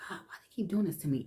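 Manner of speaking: fast, breathless whisper to God; whispered prayer. Retold or imitated. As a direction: rush a secret plea under your breath. Child praying to God secretly.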